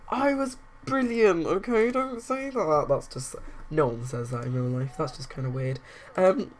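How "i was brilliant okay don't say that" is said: higher, hurt voice for the vain sim; offended Darren. Retold or imitated. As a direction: defend your pride, a touch whiny. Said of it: "And he's like".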